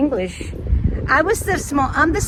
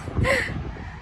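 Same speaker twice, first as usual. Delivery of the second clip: breathy giggle; 12 semitones higher, 7 dB quieter than usual. Real, not acted. Laughs about delicious cat food.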